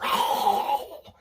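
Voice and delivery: loud, high ravenous growl; imitated dog gobbling. Acting out the dog tearing into meat, gobbling growl. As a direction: devour it noisily like a starving dog.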